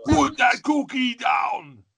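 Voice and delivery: put-on slow, high shouted Austrian-accented command; Arnold Schwarzenegger. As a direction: yell it like Arnold, very slowly. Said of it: "Arnold Schwarzenegger's".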